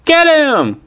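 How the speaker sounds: high, urgent cry; excited high-pitched shout. A narrator's reading. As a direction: cry it out high to send friends chasing. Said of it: "cried Annie".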